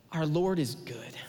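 calm confident declaration; mock morning declaration. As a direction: say it like a daily vow. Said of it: "wake up and go".

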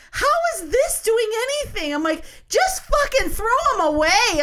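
exasperated outburst. Retold or imitated. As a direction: let it burst out, half laughing, fed up. Exasperated at security over wipes.

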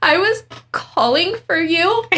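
high-pitched retold indignant protest. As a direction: plead your case, hammed-up. Retold upset reply to coworker.

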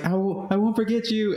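high, hammed-up heartfelt goodbye; mock dramatic farewell. Retelling the film's wall-jump scene, hammed-up high farewell.

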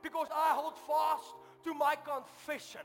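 loud, high shout at the devil; defying the devil out loud, high and loud; loud declaration. Retold or imitated. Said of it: "I said it audibly".

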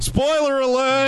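high, slow mock-announcer warning; dramatic spoiler alert. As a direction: sound the alarm like a trailer voice. Mock warning before the accent.

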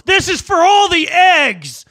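high, loud, slow crazed holler; hammed-up battle cry; yelling. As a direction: yell it like a crazy attacker, drawing out the words. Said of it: "and he yells".

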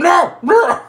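high, loud cartoonish cry; hammed-up high yell; mock cry of protest. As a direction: wail it out in mock horror. Acting out the hand bursting out.

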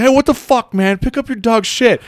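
high-pitched mock outburst, volume held in check; losing his mind. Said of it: "loses his mind".

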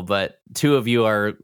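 ordinary talk

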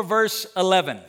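ordinary delivery

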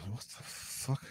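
near-whispered retold disbelief; hushed bewilderment. Dreamer wakes to a strange light at the window, whispering in confusion. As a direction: mutter it under your breath, baffled. Groggy reaction on waking in bed.

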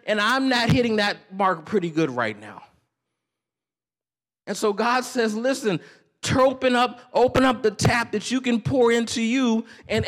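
loud, high-pitched preaching climb; preaching at full force. Acted. Pastor pressing the congregation, rising preaching. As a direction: build the point like a pulpit preacher.

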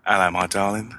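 relaxed South London greeting at normal pitch; easygoing South London accent. Shown as a demonstration. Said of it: "South London accent's more like this".

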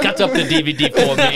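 high, loud, laughing plea; high, laughing request; laughing joke. Pretend. Joking while laughing.

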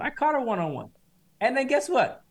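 normal talking voice

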